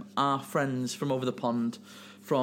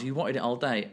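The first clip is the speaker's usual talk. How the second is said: ordinary delivery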